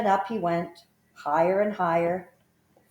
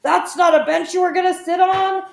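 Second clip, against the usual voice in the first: much higher, much louder bellow; elephant bellowing; 12 semitones higher, 8 dB louder than usual. A narrator's reading. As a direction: bellow a warning at the children. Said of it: "he bellowed".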